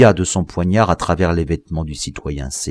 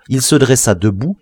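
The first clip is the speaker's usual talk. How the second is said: ordinary delivery, nothing marked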